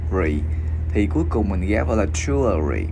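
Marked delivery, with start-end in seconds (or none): 0.1-0.4 "rì" with lips rounded forward on the R; pouted R. Shown as a demonstration. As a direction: pout into the R of "rì", pitched low. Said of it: "chữ R này thì mình chu môi". ("rì", chu môi đọc âm R, giọng trầm)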